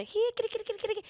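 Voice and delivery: high, soft, sing-song cooing to a stray cat; tiny high kitty-call, meowing softly. Shown as a demonstration. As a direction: coax the kitty out of the bushes with a high little meow. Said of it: "my cat voice or whatever".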